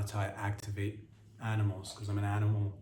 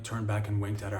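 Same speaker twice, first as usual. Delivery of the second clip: normal talking voice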